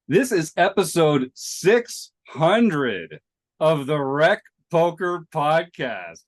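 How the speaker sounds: slow, loud, booming milestone announcement; big announcer voice. Acted. Host booms the six hundredth episode to listeners, announcer style. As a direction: stretch it out like a game-show announcer.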